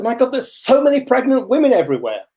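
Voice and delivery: high, fast amazed exclamation; mock astonishment; amazed pregnant woman. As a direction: gasp it out as if seeing them everywhere. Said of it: "she says".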